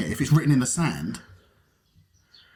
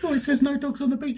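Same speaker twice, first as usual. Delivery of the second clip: put-on high, teasing girlfriend voice; role playing girlfriend; 9 semitones higher than usual. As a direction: play the date reading the sign. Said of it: "role playing".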